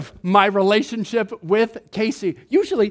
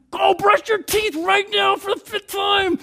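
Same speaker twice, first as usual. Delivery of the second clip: put-on higher, shouted order to kids; dad yelling; 7 semitones higher than usual. Dad yelling at kids to brush teeth, mock shout. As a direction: bark it like an exasperated dad. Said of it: "dad is yelling at them".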